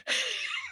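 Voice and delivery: a sudden squeal of real laughter; cracking up, pitch shooting way up; high burst of laughter. Real, not acted. Laughing at the grandmother stealing silverware, high squealing laugh.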